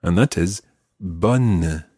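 "bonne" with an open o and clear final n, said slowly; careful French "bonne"; slow model bonne. Shown as a demonstration. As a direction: contrast "bonne" with bon. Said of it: "slightly different version of the word".

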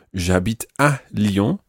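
"à" held and set apart before "Lyon"; stress on À. Shown as a demonstration. Teacher recaps which preposition to use, "à" slowly picked out. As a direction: slowly point up "à" in "J'habite à Lyon".

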